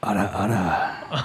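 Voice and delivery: low, slow teasing ara ara; seductive ara ara. Acted. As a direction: purr it low like a teasing anime lady. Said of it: "an ada ada from [name]".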